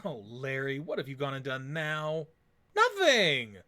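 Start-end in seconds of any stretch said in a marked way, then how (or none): 2.7-3.6 high, loud denial; caught-out protest; defensive outburst. Acted. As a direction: blurt it out too fast to be believed. Larry denies secret gift.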